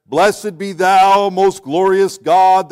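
slow, loud, lofty churchy intoning; mock pious prayer voice. Preacher mocks a rote prayer-book reader, slow loud intoning. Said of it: "you get that voice right there".

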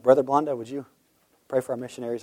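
ordinary delivery

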